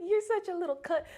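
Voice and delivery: high, loud retold insult; cussing out. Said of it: "I start cussing him out".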